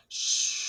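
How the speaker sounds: long whispered shh; wind whisper. A narrator's reading. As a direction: hush like wind in the leaves. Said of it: "It whispers".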